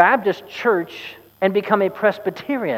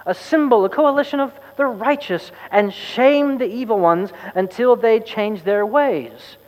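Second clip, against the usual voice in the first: preachy oracle; 6 semitones higher than usual. Acted. Exasperated oracle lecturing.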